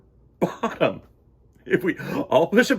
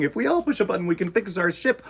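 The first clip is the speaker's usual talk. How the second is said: ordinary delivery, nothing marked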